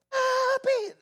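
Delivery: very high, drawn-out squealed call; child's yell. Retold or imitated. Son calling from across the house, screaming happily. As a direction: yell for Dad like an excited little boy. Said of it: "pensaba que entraba por la puerta de atrás y salió".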